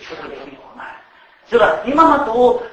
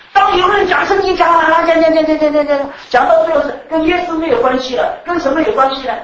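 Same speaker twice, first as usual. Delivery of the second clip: high and loud, hammering each repeat; preaching at full force; 8 semitones higher, 9 dB louder than usual. Acted. Preacher warning the church about Bible teaching, loud hammering. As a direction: drive the repeated word home.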